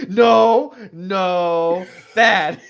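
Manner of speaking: loud, laughing no no bad; mock-scold the joke while laughing; laughing protest. Real, not acted.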